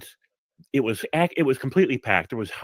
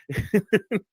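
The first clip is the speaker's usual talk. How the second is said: laugh; 10 semitones higher than usual. Real, not acted. Teller laughs at story punchline.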